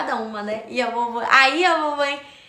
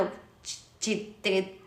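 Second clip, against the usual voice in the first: ordinary talk, nothing marked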